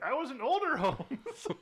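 mock older actor, high and slow; Ian McKellen. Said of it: "Ian McKellen is like".

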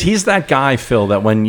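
ordinary talk, nothing marked

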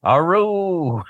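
drawn-out, high-pitched swooping howl; exaggerated cartoon politician cry; Futurama Nixon. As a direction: hoot it long and high like a cartoon catchphrase. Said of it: "Nixon character that they had on that show would always say".

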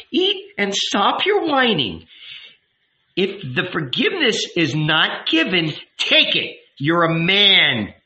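slap him down with words; scornful scolding. Acted. Woman scolding the whining youth, scornful.

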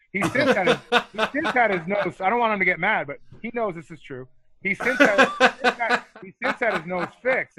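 high laughing voice, words breaking up; giggling while telling the nose story. Real, not acted. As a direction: laugh through it as you try to finish. Laughing about friend's nose.

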